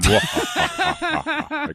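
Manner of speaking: horror-film villain cackle; Vincent Price laugh. Retold or imitated. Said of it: "Like Vincent Price".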